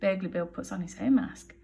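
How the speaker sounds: ordinary delivery, nothing marked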